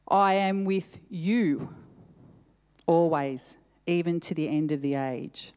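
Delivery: "you" stretched out with a pause after it; stress on YOU. Shown as a demonstration. Preacher rereads the verse word by word, stress on "you". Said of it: "put an emphasis on a different word".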